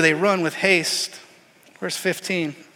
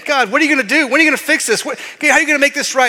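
higher, louder, rushed pleading; frantic angels pleading; 6 semitones higher, 4 dB louder, much faster than usual. Acted. Angels pleading with God about the mess, frantic and rushed. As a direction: hurry the plea, rising panic.

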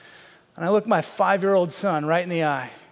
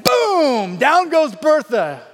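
loud, high, drawn-out play-by-play cry; big celebratory storytelling shout; triumphant climax; 7 semitones higher, 6 dB louder, much slower than usual. Retold or imitated. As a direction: boom it out like a knockout call. Climax of retold collision.

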